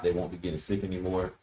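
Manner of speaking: ordinary delivery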